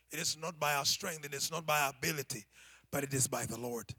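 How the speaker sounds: usual speaking voice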